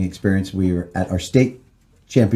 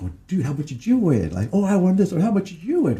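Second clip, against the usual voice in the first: excited; 10 semitones higher, 3 dB quieter than usual. Retold or imitated. Retold winners comparing winnings.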